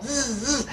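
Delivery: short imitated bee buzzes; buzzing bee. Bee alone at five A M, imitated buzzing. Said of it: "this fucking bee just starts".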